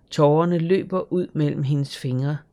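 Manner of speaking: usual speaking voice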